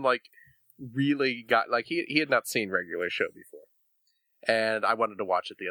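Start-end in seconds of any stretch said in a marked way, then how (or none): none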